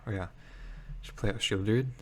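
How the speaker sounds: usual speaking voice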